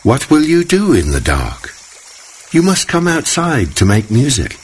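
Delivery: a worried mother's question; gentle surprised mother; mother cricket. Acted. Mother cricket urges her son.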